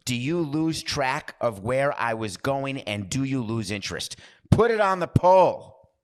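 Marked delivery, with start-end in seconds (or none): 4.5-5.9 loud high call across the room; loud, slow call to the booth; shout. Real, not acted. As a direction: shout it out to the producer. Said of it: "shouts to producer".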